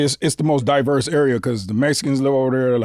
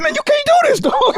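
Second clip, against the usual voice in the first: much higher, strained plea; panicked pleading; 3 dB louder than usual. Retold or imitated. Teen dropped in gang turf pleading with the cop, high and panicked. Begging cops in rival neighborhood.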